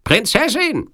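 very high-pitched Sicilian villain calling out; rufender Sizilianer. A narrator's reading. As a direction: call out in a high, piercing villain voice. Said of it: "rief der Sizilianer". (hoher Ruf des Sizilianers)

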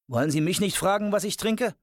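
high-pitched call after him; calling after someone. A narrator's reading. Shadow calls after Wednesday heading to the bar, calling out. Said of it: "rief ihm Shadow nach".

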